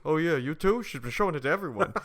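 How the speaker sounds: acted comeback. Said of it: "Remember, he goes".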